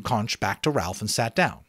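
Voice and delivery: plain reading voice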